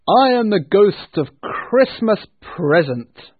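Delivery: slow, loud, grand spirit voice; booming ghost voice. A narrator's reading. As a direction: announce yourself like a giant ghost. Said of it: "said the spirit".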